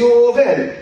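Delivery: acted irritation, calling someone out; irritated soldier.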